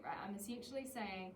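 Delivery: ordinary talk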